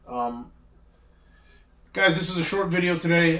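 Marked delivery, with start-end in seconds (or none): 1.9-3.4 suddenly loud and high intro. Real, not acted. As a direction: boom it out to the viewers. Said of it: "So you gotta yell at me right there?".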